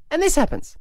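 normal talking voice

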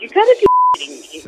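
high, fast, stunned disbelief; shocked high-pitched you gotta be kidding me. Retold or imitated. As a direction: squeal it out like you can't believe it. Learning the barber is the nephew.

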